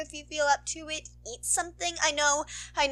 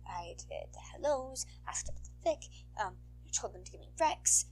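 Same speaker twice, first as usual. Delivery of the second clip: whisper; 4 semitones lower, 12 dB quieter than usual. Real, not acted. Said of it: "notes to self".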